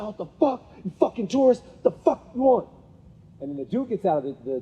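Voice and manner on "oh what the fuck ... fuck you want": high-pitched mock angry rant; super agitated. Imitating the girl in the passenger seat cursing tourists, super agitated. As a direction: snap like an irate passenger, at talking volume. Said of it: "super agitated. She was like".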